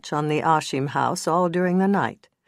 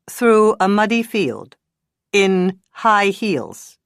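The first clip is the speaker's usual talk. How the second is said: louder, very slow, cold sarcasm; no sympathy; 5 semitones higher, 6 dB louder, slower than usual. Acted. Bad cop mocking the witness's story coldly. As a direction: let the lie hang there. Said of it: "no trace of sympathy".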